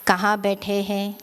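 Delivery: calm, hushed, spacious delivery; guided meditation. Real, not acted. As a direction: guide them gently into stillness, phrase by phrase.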